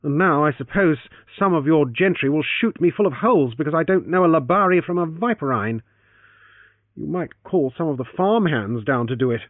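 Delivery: loud, bitter sarcasm. Acted. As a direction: spit out the sarcasm. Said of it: "said bitterly".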